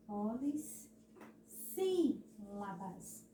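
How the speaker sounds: polis, pause, SÍ, pause, labas for Polissílabas; força no acento. Shown as a demonstration. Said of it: "Por que que a tia tá fazendo essa, essa força". (Polissílabas, pausado, com força no SÍ)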